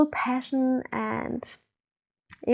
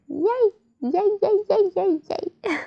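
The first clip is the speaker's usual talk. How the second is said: high, giggly string of little cheers; gleeful cheer; 7 semitones higher than usual. Acted. Meditation guide voices the joy of everything flowing, gleeful cheer. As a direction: bubble over with playful delight.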